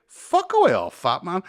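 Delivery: high, loud, sneering brush-off; a stranger scoffing at a fallen drunk; mock scornful stranger. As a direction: shoo him off in a high jeering voice. Imagined look of the bystander.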